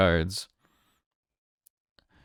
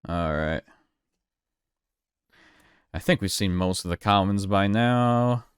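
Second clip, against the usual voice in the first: usual speaking voice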